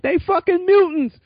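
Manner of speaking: high, loud incredulous exclamation; excited outburst. Real, not acted. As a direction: blurt it out in disbelief. Ranting about mutants vs fighters.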